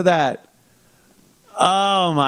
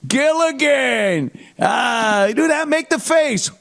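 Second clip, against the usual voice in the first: higher, rising to a mock shout; eager fan; 8 semitones higher, slower than usual. Convention fan begs Gilligan for his face, shouting. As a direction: badger him to do the face.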